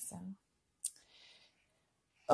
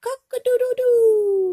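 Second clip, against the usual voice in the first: high, loud crow; hammed-up rooster call; 14 semitones higher, 12 dB louder than usual. Test time rooster sound.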